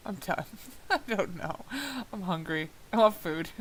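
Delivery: ordinary talk, nothing marked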